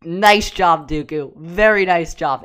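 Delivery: loud, emphatic quoted praise; mock congratulations. Fan replaying their praise for Dooku, loud and emphatic. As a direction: punch out the praise like a reaction replayed. Acts out own reaction to duel.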